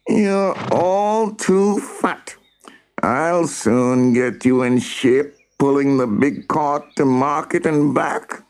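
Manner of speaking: high scolding character voice, drawn out at first; bossy farmer. A narrator's reading. Mister Moan tells off the horses for being fat, bossy. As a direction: pitch it high and threaten hard work.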